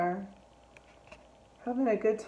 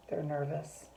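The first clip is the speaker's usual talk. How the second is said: ordinary delivery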